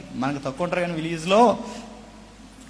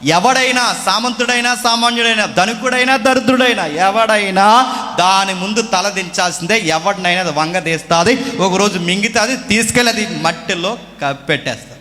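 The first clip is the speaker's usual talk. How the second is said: loud, building sermon warning of death; preaching at full force; 3 semitones higher, 6 dB louder than usual. Acted. Preacher warns about death. (గట్టిగా బోధిస్తున్న ప్రసంగ స్వరం)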